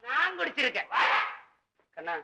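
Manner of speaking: normal talking voice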